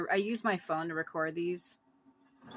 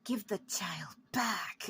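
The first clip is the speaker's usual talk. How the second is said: slow breathy ghost whisper; ghostly whisper; 4 dB quieter, slower than usual. Retold or imitated. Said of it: "these fucking voices just sound like mine".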